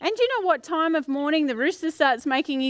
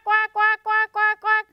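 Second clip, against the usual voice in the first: duck quacking; 7 semitones higher, slower than usual. Retold or imitated. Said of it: "Mama Duck over there going quack".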